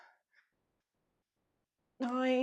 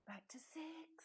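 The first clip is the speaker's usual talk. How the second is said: whispering; 7 semitones higher, 11 dB quieter, slower than usual. Real, not acted. Whispered aside while choosing weights.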